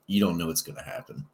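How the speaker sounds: usual speaking voice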